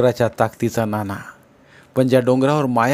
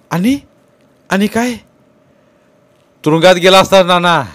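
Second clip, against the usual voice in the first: loud, high, furious shouting; raging argument; 7 semitones higher, 8 dB louder than usual. Acted. Nana rages at Tukaba in a heated quarrel. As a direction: hurl every word back in rage. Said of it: "नानाचा राग उसळला होता".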